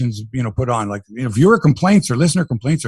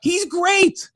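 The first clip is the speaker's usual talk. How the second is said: excited high praise; 14 semitones higher than usual. Real, not acted. Raving about Springer, echoes himself.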